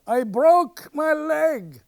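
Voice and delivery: slow, high, loud wail; hollering up in pain; yelled out. Retold or imitated. Injured actor calling up the stairs, slow loud wail. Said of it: "Franz yells out".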